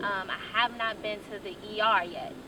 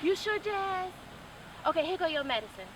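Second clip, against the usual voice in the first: high, quick fussing sister; caring sister; 8 semitones higher than usual. Retold or imitated. Imitating her sister bringing medicine, high and hurried. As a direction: hand over medicine eagerly. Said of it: "I'm like".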